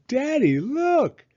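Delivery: put-on high, loud, drawn-out child's call; excited little girl. A narrator's reading. Little girl calling her father to look at her cart, excited and high. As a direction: show dad the treasure, thrilled. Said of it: "and said".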